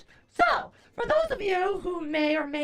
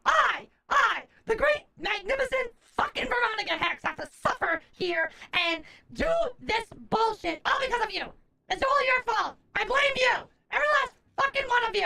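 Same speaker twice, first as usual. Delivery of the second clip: hammed-up furious tirade; ranting; 4 semitones higher than usual. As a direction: hurl the blame at everyone. Mock rant at viewers.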